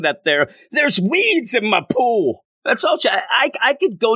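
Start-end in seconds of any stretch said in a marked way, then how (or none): 0.7-2.4 high, slow petty complaint; mock her like a fussy neighbour; whiny Morgan Le Fay. Reviewer mocking the villain's petty complaints, high and whiny. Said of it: "her walking around bitching and moaning".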